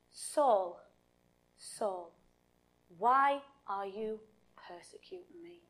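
low, soft call with long pauses, sinking to a whisper; hushed divine voice. Acted. Heavenly voice calling to Saul on the road, low and hushed.

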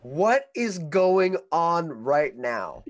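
loud, high, drawn-out question; slow, incredulous retold shout; exasperated disbelief. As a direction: stretch each word in mock bewilderment. Acted bewildered reply to stranger.

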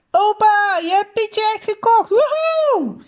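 put-on very high, overjoyed child's cry; excited squeal. A narrator's reading. Boy learning grandpa bought Pietje, squealing with excitement. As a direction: squeal it out, ending in a soaring whoop. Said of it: "Mats gilde het uit".

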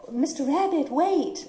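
put-on high girlish Alice voice; a young girl calling out; little girl voice. Voice actress recalls Alice line.

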